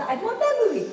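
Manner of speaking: high, loud mock gushing with a laugh; gushing fan. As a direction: imitate an excited outburst from the pews. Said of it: "Somebody just".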